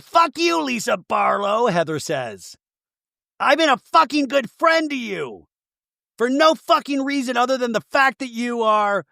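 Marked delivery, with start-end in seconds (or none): 0.0-1.7 very high, drawn-out imitation; mock furious insult. Retold quarrel. 3.4-9.1 high, insistent imitation; mock wounded outrage. Retold quarrel.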